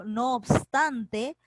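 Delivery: "no obstante" drawn out word by word; slow dictation. Shown as a demonstration. Teacher dictating a key term. (dictado muy lento)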